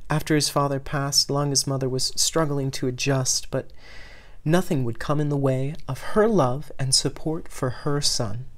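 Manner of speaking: plain reading voice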